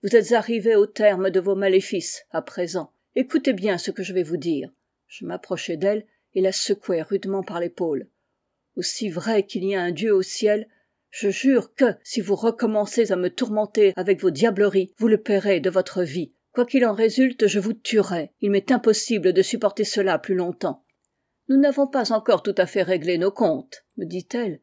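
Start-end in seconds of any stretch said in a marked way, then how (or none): 0.0-20.8 angry threats. Acted. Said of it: "lui criai-je". 21.5-23.8 louder, cold fury; match his anger word for word; angry. Acted. Woman answers the narrator with equal anger. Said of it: "avec une colère égale à la mienne".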